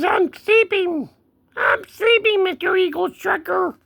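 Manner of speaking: very high kitty protest; cat voice. Pretend. Cat telling Eagle Tracker he's sleeping, high cat voice. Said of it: "He says".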